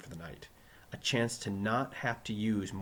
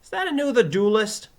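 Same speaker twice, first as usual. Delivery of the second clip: put-on high, loud Brooklyn-accented question; Joey; 12 semitones higher, 11 dB louder than usual. Pupil questioning his teacher, high and loud. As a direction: ask loudly in a high voice.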